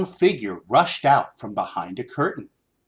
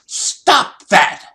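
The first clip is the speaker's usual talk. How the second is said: high, loud scolding; angry saleswoman scolding a customer; 10 semitones higher, 8 dB louder than usual. A narrator's reading. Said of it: "An angry saleswoman pointed at him, scowling".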